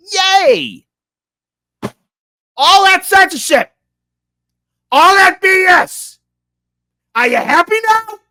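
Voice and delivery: high, loud mock cheer turning into a yell; sarcastic shouting. Real, not acted. Ranting at Democrats about censorship, sarcastic high shout. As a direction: yell it up high like a bitter fake celebration.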